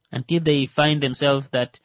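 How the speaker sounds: ordinary delivery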